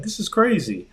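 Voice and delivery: high-pitched retold disbelief; incredulous. Shopper reacting to Xbox pricing, incredulous. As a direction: exclaim it high, as if stunned. Quoting own reaction to prices.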